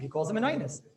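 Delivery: ordinary talk, nothing marked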